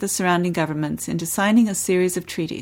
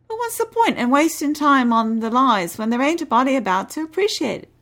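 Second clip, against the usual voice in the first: put-on higher, brash Cockney boy voice; cheeky cabin boy; 5 semitones higher than usual. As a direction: play the cocky lad showing off. Cheeky cabin boy answers commander.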